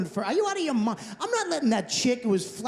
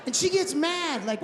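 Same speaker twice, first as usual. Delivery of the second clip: usual speaking voice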